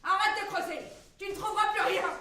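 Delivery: loud, high shouted order; calling out. Acted. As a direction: yell at her to stop, across the garden. Crie vers celle qui creuse. (ordre crié de loin)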